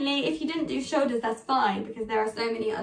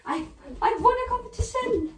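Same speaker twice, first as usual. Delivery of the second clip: much higher, gasping with joyful excitement; teacher showing pupils a happy winner, much higher and excited; overjoyed demo; 13 semitones higher than usual. Shown as a demonstration. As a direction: burst out thrilled about the win. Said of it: "So let's go".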